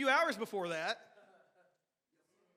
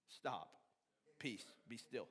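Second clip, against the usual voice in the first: much softer and lower, a hushed rebuke; calm command; 9 semitones lower, 13 dB quieter, slower than usual. Acted. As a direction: speak peace over the waves quietly. Jesus rebukes the sea.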